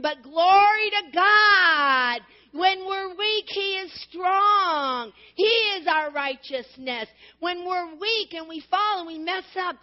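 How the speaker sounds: loud, high, slow climax of a sermon; rising preacher's cry, drawn out on strong; full-force preaching. Acted. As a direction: lift it up and shout the praise.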